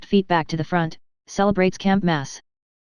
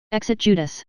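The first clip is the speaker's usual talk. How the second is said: plain reading voice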